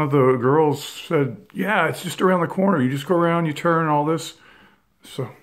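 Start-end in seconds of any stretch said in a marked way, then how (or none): 1.5-4.3 a lightly voiced young woman, higher and a bit faster; quick, breezy directions in a higher voice; imitating a girl giving directions.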